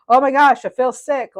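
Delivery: loud mock exclaim, then easing off; queasy rider. Reader imitating a roller coaster rider feeling sick, loud mock exclaim. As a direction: act out a dizzy rider about to be sick. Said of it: "I'm thinking a roller coaster".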